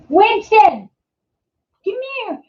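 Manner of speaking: high coaxing call to the puppy; calling the dog. Real, not acted. Owner calls puppy Winston over, high sing-song pet voice.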